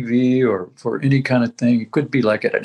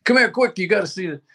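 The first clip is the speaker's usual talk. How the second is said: high-pitched mock yell from wife; imitated yell; 7 semitones higher, faster than usual. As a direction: call out urgently like his wife, without real volume. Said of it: "she calls, she yells at me".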